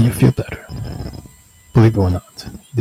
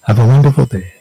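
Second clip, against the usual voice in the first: bright, pushed sarcastic sign-off; overly cheerful with sarcasm underneath; 3 semitones higher, faster than usual. Shown as a demonstration. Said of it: "See, that's sarcastic too".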